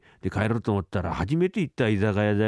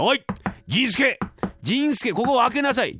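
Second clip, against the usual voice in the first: master calling out; 11 semitones higher than usual. Acted. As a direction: yell for the clerk to open up.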